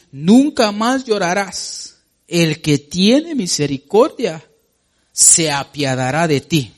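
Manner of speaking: preaching at full force. Acted. Preacher declaims scripture.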